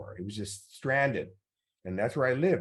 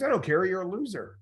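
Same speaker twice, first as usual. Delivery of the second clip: dismissive sneer; 9 semitones higher than usual. Retold or imitated. Guest brushing off Kirk's protest, high scornful sneer.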